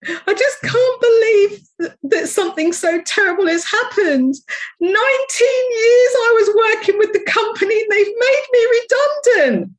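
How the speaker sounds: mock the caller's sobbing wail; imitating sobbing. Retelling a sobbing caller, hammed-up crying. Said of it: "And she's sobbing".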